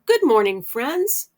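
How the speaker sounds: loud, bright hello to the class with a rising "friends"; loud opening "Good morning" sliding up high on "friends"; cheery circle-time greeting. Shown as a demonstration. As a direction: greet the little ones like it's the best part of the day. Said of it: "you can begin circle time by saying".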